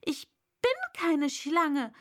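put-on affronted little girl; indignant. A narrator's reading. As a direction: protest with offended emphasis. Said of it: "sagte Alice mit Entrüstung".

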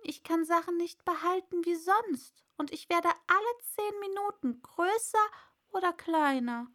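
put-on a distressed young girl; Alice. Alice voiced. (hohes, aufgeregtes Mädchen)

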